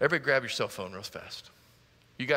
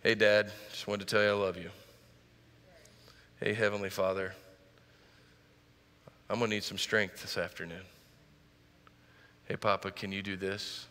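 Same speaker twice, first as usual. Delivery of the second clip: gentle prayer text; 8 semitones lower, 5 dB quieter than usual. Retold or imitated. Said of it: "just text him what you're thinking".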